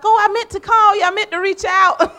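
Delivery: high-pitched mock apology; hammed-up sheepish excuse, ending in laughter; excuse-making friends. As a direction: imitate awkward excuses, way up high. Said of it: "And then they'll be like".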